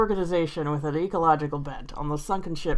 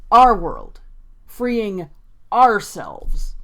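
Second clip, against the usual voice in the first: "Our world", "ourselves" said out firmly in separate beats; stress on OUR; 6 dB louder, much slower than usual. Shown as a demonstration. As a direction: punch "Our" and "ourselves", pausing between phrases. Repeats quoted words to underline them.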